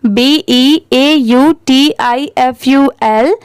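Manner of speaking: slow letter-by-letter spelling; spelling aloud. Shown as a demonstration. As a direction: spell each letter slowly for the class. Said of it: "इसे हम क्या पढ़ेंगे?". (धीरे-धीरे अक्षर बोलकर spelling)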